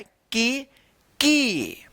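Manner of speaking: की with a drawn-out long ee. Shown as a demonstration. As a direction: stretch the vowel in की. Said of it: "थोड़ा लंबा उच्चारण किया जाता है".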